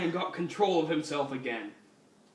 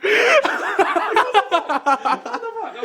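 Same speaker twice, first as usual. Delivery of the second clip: high, slow words through laughter; laughing so hard words crack; hysterical laughter; 14 dB louder, much slower than usual. Real, not acted.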